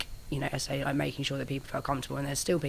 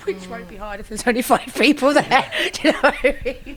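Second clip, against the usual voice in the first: laughing; 9 semitones higher, 10 dB louder than usual. Real, not acted. Joking about tiny crowd.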